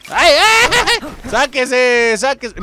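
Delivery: high, loud frightened shrieks; scared screaming. Real, not acted. Streamer jumped by a ghost in the game, frightened shrieks. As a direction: scream and shoo it off.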